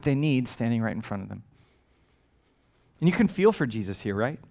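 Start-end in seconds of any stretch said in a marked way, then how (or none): none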